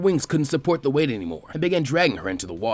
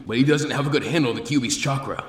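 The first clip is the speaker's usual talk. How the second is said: ordinary delivery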